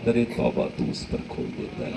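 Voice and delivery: soft, low, half-breathed; whispered devotional verse; hushed line of verse. Real, not acted. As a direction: breathe the couplet gently. Sung couplet, breathy.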